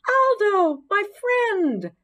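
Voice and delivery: very high, loud happy hamster cry; squealing with delight; excited squeak. A narrator's reading. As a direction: greet your old friend with glee. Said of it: "I squeaked as I jumped".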